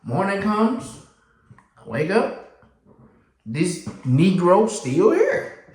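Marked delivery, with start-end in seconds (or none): 3.4-5.6 slow, laughing; laughing disbelief. Real, not acted. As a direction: chuckle at the nerve. Laughing disbelief next morning.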